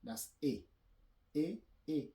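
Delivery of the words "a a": "A, A" as two short, separate closed é sounds; French é sound. Shown as a demonstration. Teacher repeats the sound for learners, French é sound. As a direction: say "A" like French é, higher and louder. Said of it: "it sounds A".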